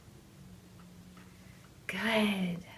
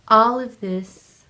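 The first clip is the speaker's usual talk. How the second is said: emphatic; 12 dB louder, slower than usual. Acted. Emphatic coaching line.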